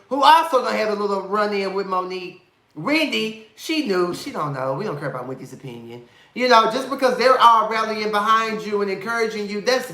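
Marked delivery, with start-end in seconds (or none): 4.1-6.1 quick dismissive aside. Real, not acted.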